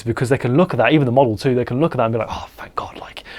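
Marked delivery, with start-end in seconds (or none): 2.3-3.2 hushed, breath-only sigh of relief; relieved whisper. Acted. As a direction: let it out under the breath, as a relieved client. Voices relieved clients and model.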